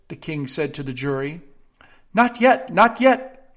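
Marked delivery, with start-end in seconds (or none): 2.1-3.5 quick, high, urgent "not yet"; hasty interruption. A narrator's reading. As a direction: rush in to cut him off. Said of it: "hastily interrupted".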